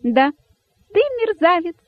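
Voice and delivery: very high, squeaky jubilation; cheeky high-pitched little cry; gleefully shouting rabbit. A narrator's reading. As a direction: pipe the insult out with joy. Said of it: "радостно закричал дерзкий кролик".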